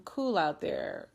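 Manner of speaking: ordinary talk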